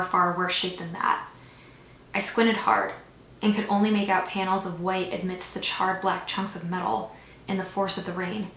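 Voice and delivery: usual speaking voice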